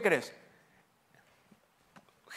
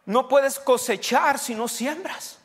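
higher, louder, slow punch; preaching; 8 semitones higher, 5 dB louder than usual. Acted. Preacher drives home the lesson to the congregation. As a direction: hammer the sowing rule home.

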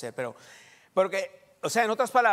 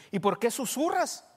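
higher, drawn-out query; puzzled question; 7 semitones higher, 3 dB louder than usual. Retold or imitated. Pastor asking the woman why she whispers, puzzled. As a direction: ask it openly, pitched up.

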